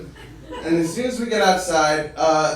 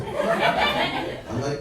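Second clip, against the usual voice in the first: normal talking voice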